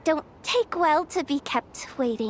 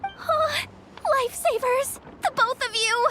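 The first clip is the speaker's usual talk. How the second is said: grateful relief; 8 semitones higher than usual. Acted. Grateful to helpers taking over.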